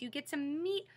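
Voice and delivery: usual speaking voice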